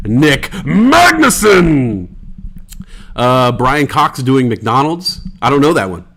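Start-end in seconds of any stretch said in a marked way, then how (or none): 0.1-2.1 long, drawn-out call, much higher and louder; shouted name. Real, not acted. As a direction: bellow the name out slowly. Calling out a chat viewer.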